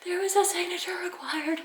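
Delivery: high, breathless apologetic voice. Retold or imitated. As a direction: explain sheepishly, out of breath. Panting explanation to driver.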